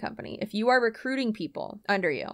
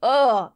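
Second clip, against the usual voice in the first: loud disgusted ugh; 2 semitones higher, 8 dB louder than usual. Real, not acted. Reacting in disgust.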